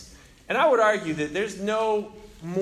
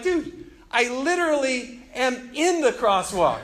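higher, slow emphatic protest; retold exasperation; 6 semitones higher than usual. Pedestrian arguing back at the car, exasperated. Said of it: "I throw up my hands".

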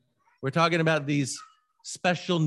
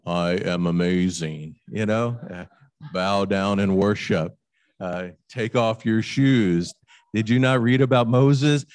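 loud, lower, grandiose God voice demanding worship; booming God; 5 semitones lower, 6 dB louder than usual. Pretend. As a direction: boom it out like a vain deity. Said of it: "He could have said".

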